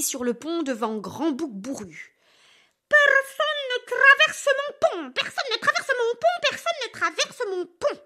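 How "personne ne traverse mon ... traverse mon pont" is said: much higher, loud repeated roar, fading; furious troll. Acted. Furious troll blocks the big goat, roaring then fading. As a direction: bellow it three times, then run out of steam. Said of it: "Le trôle devient furieux".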